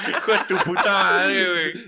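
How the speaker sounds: slow words through a loud laugh. Real, not acted. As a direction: laugh hard while cursing. Cracking up at joke.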